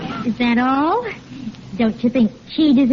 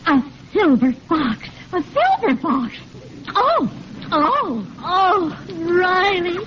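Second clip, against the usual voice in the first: swooping, awestruck exclamations; overwhelmed delight; 5 semitones higher than usual. Acted. Wife finding a silver fox in the box, overwhelmed. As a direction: marvel in rising gushes of wonder. Wife thrilled by fur gift.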